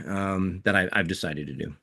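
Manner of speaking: ordinary delivery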